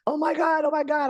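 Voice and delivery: high-pitched starstruck squeal; excited fangirling. Retold or imitated. Fan meeting the author, starstruck high squeal. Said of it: "I was, like, fangirling".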